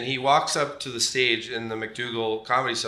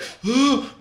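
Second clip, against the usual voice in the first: mock gasp; 11 semitones higher, 5 dB louder than usual. Bobby's reaction imitated.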